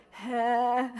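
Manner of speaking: joking replay of a strangled nightmare wail; drawn-out wordless moan, done for a laugh; mock cry for help. Stressed friends call each other with the inside joke, mock nightmare wail. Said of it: "we'll all be like".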